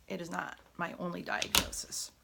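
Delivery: usual speaking voice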